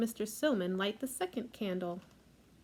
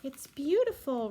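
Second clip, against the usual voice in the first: put-on delighted girl; 5 semitones higher, 5 dB louder than usual. Girl delighted by dollhouse gift.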